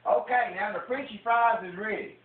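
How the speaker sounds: high, loud Southern TV-cook character; high-pitched country cook for the camera; put-on Southern character. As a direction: launch into a hammed-up Southern cooking-show character. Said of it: "You see how I changed character".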